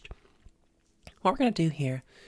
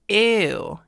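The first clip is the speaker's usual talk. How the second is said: loud, thick, disgusted "ew"; heavy vocal weight, disgusted; emphatic ew; 5 semitones higher, 8 dB louder than usual. Shown as a demonstration. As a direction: push it out heavy, almost frying. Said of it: "emphatic ew sound".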